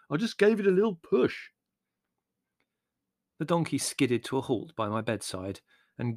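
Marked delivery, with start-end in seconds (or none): 0.1-1.5 high-pitched defensive protest; indignant. A narrator's reading. Larry defending himself to Margot, indignant. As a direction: excuse yourself with injured innocence. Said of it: "said Larry indignantly".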